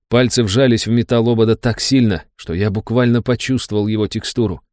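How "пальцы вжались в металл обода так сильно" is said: plain reading voice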